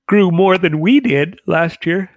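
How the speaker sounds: talking through a yawn; words stretched by a big yawn. Real, not acted. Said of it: "Sorry to yawn".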